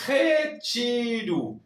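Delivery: retiro drawn out as rechiru; slow Portuguese retiro. Shown as a demonstration. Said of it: "Esta palabra está muy interesante".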